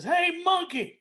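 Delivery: high-pitched call to someone up a tree; high joke-teller's holler. Acted. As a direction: call out to get their attention, pitch jumping up. Joke's alligator calls up to monkey.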